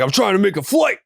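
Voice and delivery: high, defensive mock excuse; man cutting the line, whining high; mock flustered passenger. Man pushing past in line.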